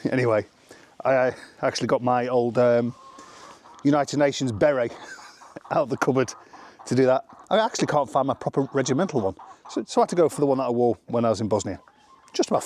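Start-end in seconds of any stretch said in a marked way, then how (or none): none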